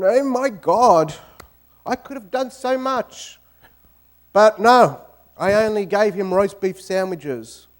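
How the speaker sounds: theatrical grief, a touch of sobbing; hammed-up wailing. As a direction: wail like an over-the-top widow. Widow wailing at cemetery.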